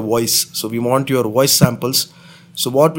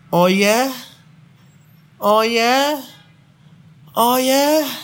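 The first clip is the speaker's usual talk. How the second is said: each oh yeah climbing higher; building oh yeah; 11 semitones higher, slower than usual. Shown as a demonstration. Caller demonstrating oh yeah with rising intensity. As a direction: push each one higher and hotter. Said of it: "Just say".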